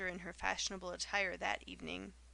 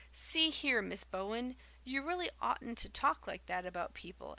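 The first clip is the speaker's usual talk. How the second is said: plain reading voice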